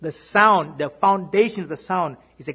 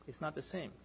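hushed echo; 17 dB quieter than usual. Acted. Preacher echoes his point to the congregation, hushed. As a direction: drop to a near hush on the repeat. Softer repeated phrase.

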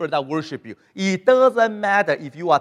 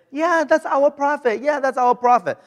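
high, approving crowd voice; 7 semitones higher than usual. Retold or imitated. Israelites praising their prophet.

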